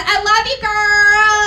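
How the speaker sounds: high, loud cheer; cheering for Raven; excited shout-out. Real, not acted. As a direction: call it out to your friend. Shout-out to a friend.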